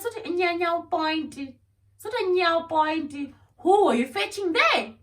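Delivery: high, mocking echo turning into a loud demand; mocking confrontation. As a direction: mimic his dodge, then demand an answer. Mocking his question, confronting.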